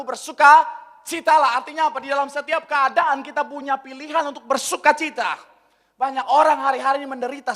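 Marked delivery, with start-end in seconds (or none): 0.0-1.6 Bersukacitalah drawn out slow and loud, every syllable weighted; slow emphatic. Real, not acted. Pastor repeats the scripture command to the congregation: slow, loud, emphatic. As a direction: proclaim Bersukacitalah slowly, louder and with weight. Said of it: "Sekali lagi katakan kepadamu".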